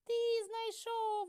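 very high, loud, slow mouse shout. A narrator's reading. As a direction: shout it out together like eager mice. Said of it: "голосно закричали мишенята".

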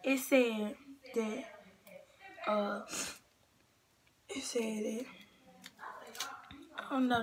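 ordinary talk, nothing marked

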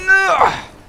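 high, loud scream of no; shrill desperate no; panicked shout. Real, not acted. Shout at game.

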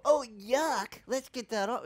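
high, lightly voiced kid saying yuck; a hint of a grossed-out child; child wiping off kisses, lightly disgusted; mock disgusted kid. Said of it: "we didn't go".

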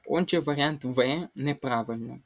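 ordinary talk, nothing marked

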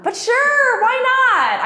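a breezy, falsetto-ish shrug of a yes; high mock-chirpy yes. Teen agreeing to a maybe-scam publisher, high and chirpy.

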